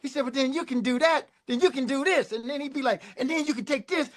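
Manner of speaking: a boss getting carried away. Retold or imitated. Prince piling on ideas.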